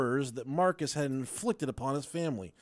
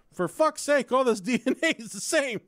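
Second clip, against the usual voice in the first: loud, higher exasperated outburst; exasperated exclaim; 7 semitones higher, 4 dB louder than usual. Retold or imitated. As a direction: blurt it out in disbelief. Investigators exclaiming in retold quote.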